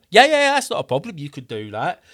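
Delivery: quick, high and upbeat customer-service voice; high, fast mock call-centre voice; breezy phone agent. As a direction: play the cheery agent brushing it off. Tow company agent on phone.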